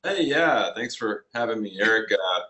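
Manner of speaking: usual speaking voice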